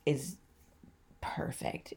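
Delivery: fading to a whisper on perfect; hushed. Real, not acted. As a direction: let it trail into a breath. Gushing about the retreat venue.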